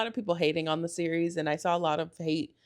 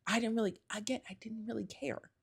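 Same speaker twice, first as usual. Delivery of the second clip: whispered aside; 2 semitones higher, 8 dB quieter than usual. Real, not acted. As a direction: drop to a breathy whisper for the aside. Aside on not caring about story.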